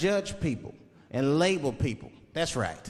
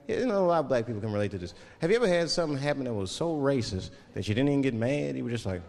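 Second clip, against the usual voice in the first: usual speaking voice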